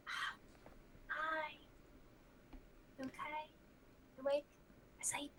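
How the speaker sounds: quiet, hesitant hello to a sleeping friend; soft in-character check-in. Acted. Arya softly asks the stirring Zofiel if he's awake, quiet and hesitant. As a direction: gently ask if he is awake.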